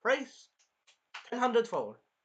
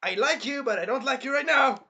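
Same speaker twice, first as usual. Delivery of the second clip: mock scolding; 7 semitones higher, 7 dB louder than usual. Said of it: "I'm like".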